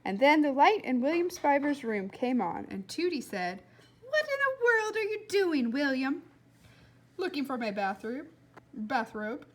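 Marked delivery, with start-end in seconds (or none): 4.0-6.2 high, puzzled neighbour calling; a woman calling from her window; lightly voiced neighbour woman. Neighbour calling out to the boy at night, high puzzled call.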